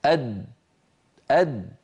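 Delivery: أد shut tight, no release; stopped daal. Shown as a demonstration. Teacher showing a stopped daal. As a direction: close أد firmly. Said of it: "جربوا لو سمحتم".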